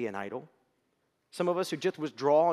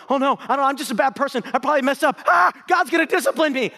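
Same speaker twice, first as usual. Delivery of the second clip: high, loud, panicked guilt; anxious self-blame; 13 semitones higher, 15 dB louder than usual. Retold or imitated. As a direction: spiral into frantic shame. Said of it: "and you're going".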